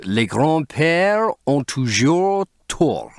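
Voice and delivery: slow, savoured French; lingering French quip; French phrase. Acted. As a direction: roll the French out slowly. Lord Henry quotes French aphorism.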